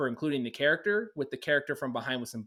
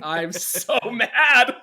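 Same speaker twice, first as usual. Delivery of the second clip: drawn-out, loud mock frustration; mock angry; 8 semitones higher, 10 dB louder, much slower than usual. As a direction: wail it slowly and loudly, half joking. Playful mock frustration, joking.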